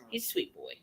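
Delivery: soft pet talk. Real, not acted. Soothing her dog.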